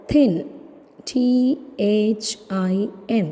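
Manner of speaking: ordinary talk, nothing marked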